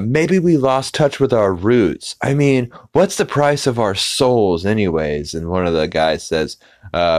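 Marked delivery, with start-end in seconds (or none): 0.0-5.3 high, eager elder voice full of envy. Acted. Casino chief envies Peter's vision, high and eager. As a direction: pitch it up and gush with longing. Same character muses.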